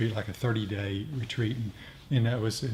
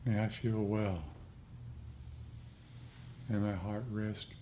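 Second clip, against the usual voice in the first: guided meditation; 5 dB quieter than usual. Acted. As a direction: lead the group gently into metta. S0 leads metta meditation.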